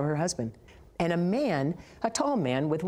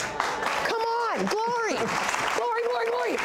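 very high celebratory cries; exultant preacher. Retold or imitated. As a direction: imitate a revival preacher shouting glory.

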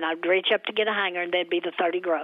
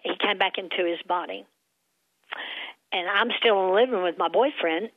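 ordinary talk

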